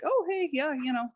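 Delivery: breezy, mild retold nonchalance; casual. Child announcing she's home to her mom, casual. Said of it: "I was just like".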